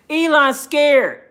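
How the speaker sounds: usual speaking voice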